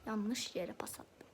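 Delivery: slow, low whisper of regret; rueful slow whisper. Real, not acted. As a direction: whisper the mistake to yourself. Whispering regret over a bad pass.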